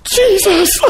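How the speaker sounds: put-on high childlike wonder, breaking into laughter. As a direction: squeal it like a delighted child. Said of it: "just childlike".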